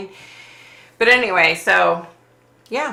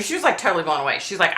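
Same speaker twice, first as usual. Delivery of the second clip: normal talking voice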